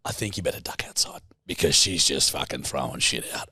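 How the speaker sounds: urgent hushed whisper; retold whisper. Brother warns Jack about Steph, hushed whisper. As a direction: whisper it like a secret warning. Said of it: "And he goes".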